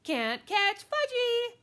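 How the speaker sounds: very high, slow, loud taunt; drawn-out high little-kid yell; hollering toddler. Acted. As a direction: holler a teasing dare like a small child. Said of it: "he hollered".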